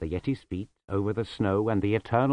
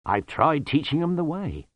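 proudly; 8 semitones higher, 7 dB louder than usual. Acted. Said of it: "said Lutzer proudly".

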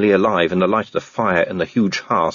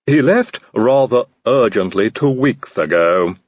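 slow, polite servant voice with a different timbre; a courteous, slightly sinister butler; Igor the servant; 2 semitones higher, 4 dB louder, slower than usual. A narrator's reading.

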